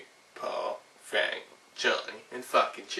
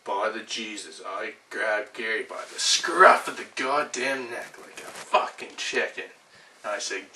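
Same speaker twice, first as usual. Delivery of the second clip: ordinary talk, nothing marked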